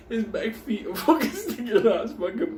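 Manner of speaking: high-pitched talk through laughter; cracking up. Real, not acted. Storyteller picturing the dog in the bag, cracking up. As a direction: laugh right through the words.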